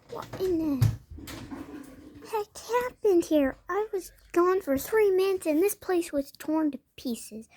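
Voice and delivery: high-pitched, dismayed female dog voice; shocked female dog. Acted. Female dog finds the place wrecked, shocked.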